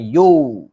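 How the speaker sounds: loud, drawn-out "yo" with round o; wrong vowel. Shown as a demonstration. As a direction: hold "yo" on an o, no glide. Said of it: "Bukan yo".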